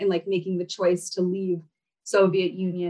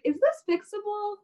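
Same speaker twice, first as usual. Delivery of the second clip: worried question; 11 semitones higher, 4 dB quieter than usual. Retold or imitated. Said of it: "And I was like".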